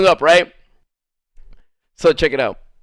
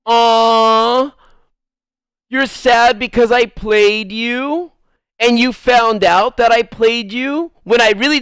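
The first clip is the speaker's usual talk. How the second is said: mock sympathy; 3 semitones higher than usual. Man mimicking her guilt trip, mockingly sympathetic. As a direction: coo a sarcastic "aw" and stretch out each question. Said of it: "trying to make me feel bad is like this".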